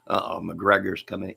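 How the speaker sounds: ordinary talk, nothing marked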